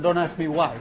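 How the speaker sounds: normal talking voice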